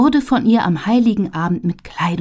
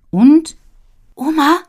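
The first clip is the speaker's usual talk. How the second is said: put-on higher, louder eager child; quick eager call; 9 semitones higher, 6 dB louder than usual. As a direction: blurt it out eagerly. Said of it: "sagt Ziff schnell".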